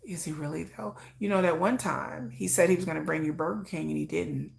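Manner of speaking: soft, low, doubting; doubting inner voice. Pretend. The doubting voice in her head, soft. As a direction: murmur it like a nagging doubt.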